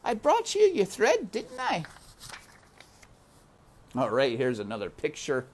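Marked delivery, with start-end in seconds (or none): 0.0-1.8 very high, eager question; Mig offering. A narrator's reading. Mig giving the princess the thread, high. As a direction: hand it over eagerly.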